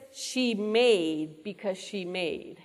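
"made" drawn out slowly and set apart; slow emphasis on MADE. Real, not acted. Preacher pointing listeners to the verse's key word, slow stress on "made". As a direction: dwell on "made", taking the phrase very slowly.

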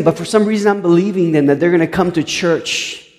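ordinary talk, nothing marked

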